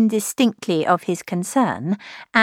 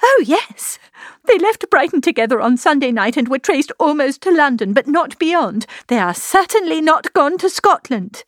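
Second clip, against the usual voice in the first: high, distressed confirmation; upset voice, pitched high; agitated; 7 semitones higher, 3 dB louder than usual. A narrator's reading. Agitated answer about the elopement.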